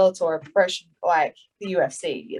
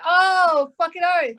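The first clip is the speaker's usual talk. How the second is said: retold delight; 9 semitones higher, much slower than usual. Retold delight at meeting fellow Aussie.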